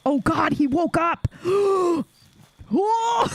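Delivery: shocked shriek at the NPC waking; startled shout. Real, not acted. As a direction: yelp in real surprise as he jumps up. NPC suddenly wakes and attacks.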